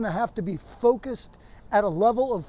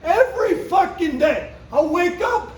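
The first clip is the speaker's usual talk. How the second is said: high, loud, punched-out stage tirade; shouted stand-up rant; 9 semitones higher, 7 dB louder than usual. Acted. Comedian signing off to a crowd, defiant shouted rant. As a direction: belt it at the crowd, hammering every swear word.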